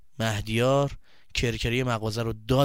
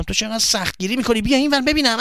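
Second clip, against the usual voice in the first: higher, rising protest; exasperated pleading; 9 semitones higher, 6 dB louder than usual. Acted. Boy pulls friend away from grandfather, exasperated. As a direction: urge him aside, getting louder.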